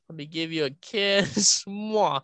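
loud, slow, cooing kiss offer; baby kisses. Pretend. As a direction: coo like smooching a baby.

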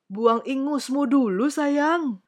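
fatherly king. Acted. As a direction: tell the child firmly as the king. King speaks to his daughter.

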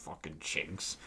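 low, unvoiced retold mutter; under his breath. Old vet muttering on the bus, under his breath. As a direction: mutter it low and soft, barely voiced. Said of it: "almost racist under his breath".

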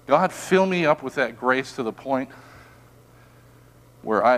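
ordinary talk, nothing marked

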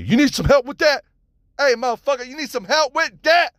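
high, loud street holler; menacing yell. Acted. Strangers jumping out at someone, high aggressive yell. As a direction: shout it high like an aggressive challenge. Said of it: "they yell at you".

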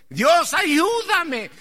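slow, high, loud plea for help; crying out to God. Retold or imitated. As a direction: cry out to God, dragging each word. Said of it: "a gritarle". (clamor lento y fuerte a Dios)

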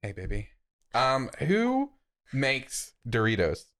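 normal talking voice

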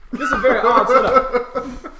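high, loud laughing remark. Acted. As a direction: laugh while saying it. Shopkeeper laughing.

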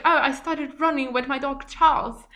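mocking politician in British accent; Boris Johnson British accent. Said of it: "I like your British accent".

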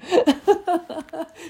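high giggle burst; high laugh. Real, not acted. Laughing at her dog's imagined complaint, high giggle. After voicing the dog.

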